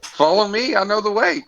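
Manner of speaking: rallying leader. Acted.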